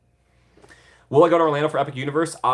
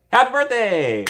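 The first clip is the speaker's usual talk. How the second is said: excited; 12 semitones higher, 4 dB louder than usual. Real, not acted. Birthday wish after song.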